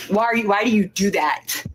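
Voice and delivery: usual speaking voice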